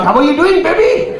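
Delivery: put-on Pakistani accent climbing high at the end; mock Pakistani accent. Jamaican man tries his luck, in a hammed-up Pakistani accent. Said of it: "Jamaican man put on a Pakistani accent".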